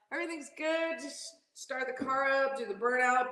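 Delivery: ordinary talk, nothing marked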